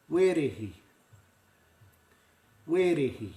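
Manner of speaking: "Werehi" weh-reh-hee with clear vowels; Māori pronunciation. Shown as a demonstration. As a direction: model "Werehi" twice for repeating. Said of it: "That's it".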